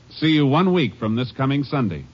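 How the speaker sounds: ordinary talk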